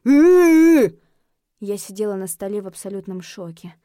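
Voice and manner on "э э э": high, very loud drawn-out groan; wordless undead wail of protest; long outraged zombie cry; indignant zombie moan. A narrator's reading. Offended zombie protests once more.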